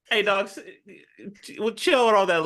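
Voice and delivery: high-pitched comic retelling; mock casual taunt. Co-host voicing the villain brushing off his rival, mock taunt. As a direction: play the quote high, on the edge of laughter.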